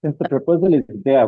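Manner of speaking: normal talking voice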